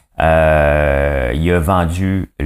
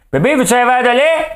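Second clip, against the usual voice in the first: mock calling out; 4 dB louder than usual. Partner offers milk to the other, high sing-out call. Acted couple scene over the milk.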